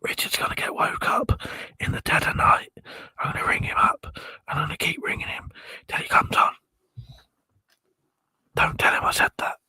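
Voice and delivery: conspiratorial stage whisper. Real, not acted. Whispering to viewers so Richard won't hear. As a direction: whisper it like a secret plot. Secret plan against sleeping Richard.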